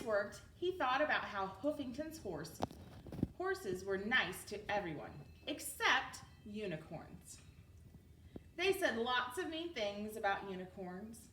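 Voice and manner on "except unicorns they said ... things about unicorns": plain reading voice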